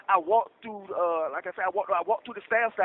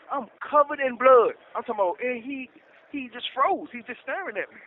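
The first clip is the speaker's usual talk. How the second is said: usual speaking voice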